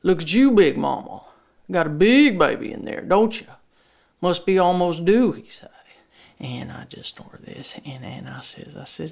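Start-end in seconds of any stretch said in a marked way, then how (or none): 0.0-5.3 a lightly voiced teasing old father, louder; lightly voiced Daddy teasing his pregnant daughter; Daddy's voice. A narrator's reading. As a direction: tease her like an old dad.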